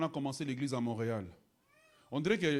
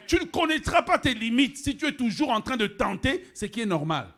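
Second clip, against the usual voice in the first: loud, high preacher's cry; full force preaching; 7 semitones higher, 12 dB louder than usual. Acted. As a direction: hammer it at the congregation. Prêche à pleine voix. (prédication à pleine voix)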